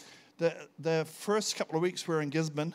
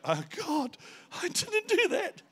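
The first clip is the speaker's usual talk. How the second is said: higher, slow, horrified protest; freaked out; slower than usual. Retold or imitated. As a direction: gasp it out like you're horrified by what happened. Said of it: "And I freaked out".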